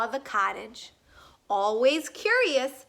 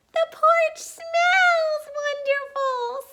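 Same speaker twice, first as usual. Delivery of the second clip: much higher, louder little cub voice; Baby Bear squeaky voice; 5 dB louder than usual. A narrator's reading. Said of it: "said Baby Bear".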